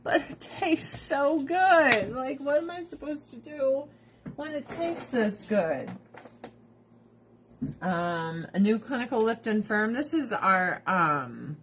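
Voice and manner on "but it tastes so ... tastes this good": high, drawn-out helpless plea; hammed-up whine; mock whiny protest. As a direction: plead your case, stretching the words. Repeats the acted helpless protest.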